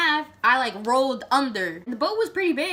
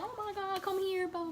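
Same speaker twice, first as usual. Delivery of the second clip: softer, higher comforting coo; soothing mom; 9 semitones higher, 10 dB quieter than usual. Retold or imitated. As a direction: hush it like a hugging mother. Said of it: "She's like".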